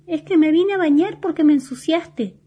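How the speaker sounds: vocecita aguda de bolita; bolita contestona. A narrator's reading. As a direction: contesta con voz de personaje chiquito. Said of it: "contesta la bolita". (juguete que se queja, muy agudo)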